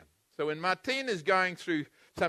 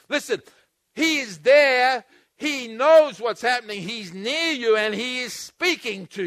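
louder, slow, drawn-out preaching emphasis; forceful preaching; 3 semitones higher, 6 dB louder than usual. Real, not acted. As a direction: drive each word home from the pulpit.

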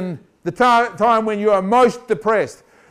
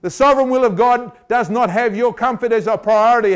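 usual speaking voice

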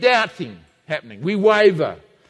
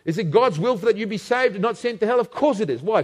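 ordinary delivery

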